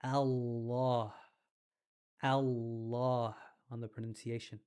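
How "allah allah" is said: Allah said slowly, the double l held; really slow. Shown as a demonstration. Teacher slowing the word down for students, slow. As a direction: draw out Allah, syllable by syllable. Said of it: "I'm gonna say it really slow".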